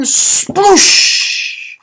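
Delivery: breathy splashing sound effect; high splashy sploosh for the kids. A narrator's reading. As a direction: spit it out like a big splash.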